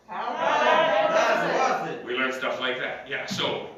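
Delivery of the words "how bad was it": slow, high heckle-style question; comedian's callback. Shown as a demonstration. Comic demonstrating a callback to the class, slow and high. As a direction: drag out the setup question high. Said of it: "if I was a comedian standing on stage".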